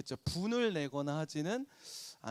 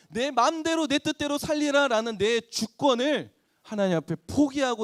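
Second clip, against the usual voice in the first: building sermon climax; 5 semitones higher, 6 dB louder than usual. Real, not acted. Preaching climax.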